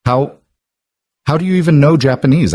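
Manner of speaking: mild flustered crack, not full breakdown. A narrator's reading. Receptionist Vanessa caught out by the janitor, voice cracking. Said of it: "Vanessa's voice cracked".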